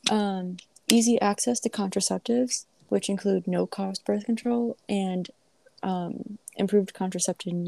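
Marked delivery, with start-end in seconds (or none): none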